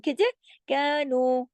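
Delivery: "कानू" slowly with long vowels; recitation demo. Shown as a demonstration. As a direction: hold each vowel of "कानू" two counts. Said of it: "दोनों दो दो हरकत".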